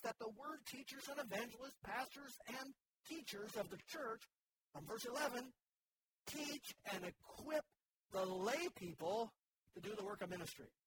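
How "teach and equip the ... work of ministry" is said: ordinary talk, nothing marked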